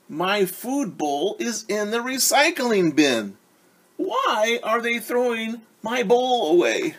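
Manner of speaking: high, slow alarmed puppy voice; a little dog fretting over his bowl; the dog panics his bowl is thrown out; worried dog. Acted. Dog narrator worried about bowl.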